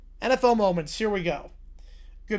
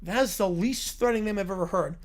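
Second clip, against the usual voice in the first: normal talking voice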